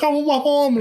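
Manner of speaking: put-on high, whimpering little-kid voice; a hint of a toddler crying for mom; whiny kid. Dropped toddler whining for his mom. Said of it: "They'd be like".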